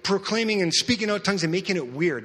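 Paul's rebuke. Retold or imitated. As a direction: scold them like Paul warning the church. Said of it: "He just kept saying".

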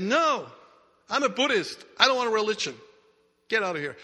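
sharp, high, loud refusal; irritated refusal. Acted. Man angrily refusing the visitor.

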